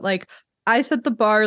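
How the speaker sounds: normal talking voice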